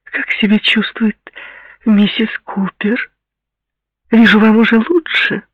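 put-on very high, venomous girl's voice; near screech. A narrator's reading. Jilted girl taunts her rival, nearly screeching. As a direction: needle the rival, pitched almost to a squeal. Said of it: "едва не сорвалась на визг".

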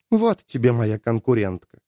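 usual speaking voice

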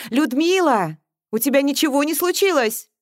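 high, loud, concerned call. A narrator's reading. Mother calls up again from downstairs, calling out. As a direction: call up through the house.

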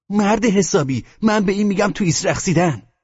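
put-on very high, loud child's voice; squeaky, loud put-on kid voice; arguing child. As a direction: pipe up as an indignant kid. Child character argues in a story.

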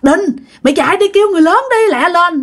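high-pitched, fast retold scolding; urgent scolding yell. Quang yelling at the frozen kid to fetch adults, urgent scolding. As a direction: yell it urgently, high and rushed, as the older boy. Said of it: "anh Quang mới la bạn".

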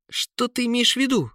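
high-pitched questioning prince; puzzled prince. A narrator's reading. Prince questions his companion. (высокий голос принца)

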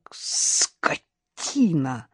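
very slow, hissed-out insult; exhaled curse. A narrator's reading. Karina seeing the bruise her husband left, exhaled fury. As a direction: breathe the curse out, drawn long. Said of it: "выдохнула она".

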